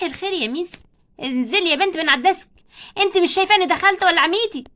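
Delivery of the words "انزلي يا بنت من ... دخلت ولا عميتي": loud, sharp teacher telling off; stern scolding. Acted. Teacher scolds a pupil sitting on the desk, loud and stern. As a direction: snap at the girl on the desk.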